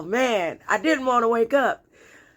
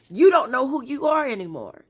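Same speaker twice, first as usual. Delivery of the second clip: usual speaking voice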